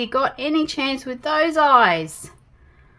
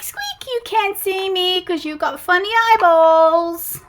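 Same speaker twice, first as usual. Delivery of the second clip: cheeky little mouse; 5 semitones higher than usual. Pretend. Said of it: "and he went".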